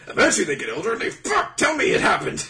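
very high, loud fanboy rant; hammed-up demand, half laughing; mock desperate shout. Crazed shipper insisting to the author, mock desperate yelling. Acted quarrel with the mangaka.

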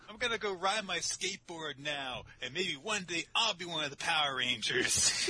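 high, put-on childlike enthusiasm; goofy kid voice. Kid off to ride a skateboard, goofy eagerness. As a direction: play an eager kid dreaming aloud. Acted kid hero after the battle.

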